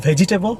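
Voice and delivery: "vegetable" in four syllables, vej-ee-tay-bul, rising; mispronunciation. Shown as a demonstration. Teacher asking if you say it this way, mispronounced. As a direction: ask "vegetable" with a full "ee" inside. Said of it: "Are you saying this word".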